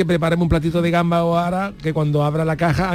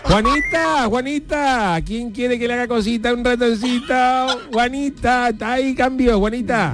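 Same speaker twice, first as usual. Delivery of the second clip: higher flirty voice; 7 semitones higher, slower than usual. Acted. Flirty walkie-talkie call.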